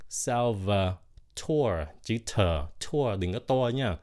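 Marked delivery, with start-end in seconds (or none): 0.1-1.9 Salvatore drawn out syllable by syllable; very slow syllable demo. Shown as a demonstration. Teacher slowly breaks Salvatore into syllables for learners. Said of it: "cái xong rồi và".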